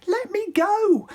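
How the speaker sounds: very high shout; high shrill cry of protest. Acted. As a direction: yell to break free. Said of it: "As Cindy shouted".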